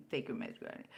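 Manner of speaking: soft unvoiced jab, Take your meds, Granny; whispered taunt. Real, not acted. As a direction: whisper it mockingly to the commenter. Taunting the troll.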